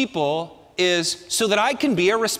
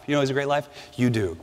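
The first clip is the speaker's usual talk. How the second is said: usual speaking voice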